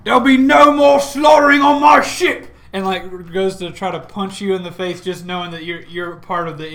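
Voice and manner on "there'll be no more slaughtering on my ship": Talazar roars at the brawlers, loud and high; furious shout. Acted. Talazar shouting down the butcher on her ship, furious shout. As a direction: bellow it as a ship captain stopping a fight. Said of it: "Talazar is gonna pull a fist back and say".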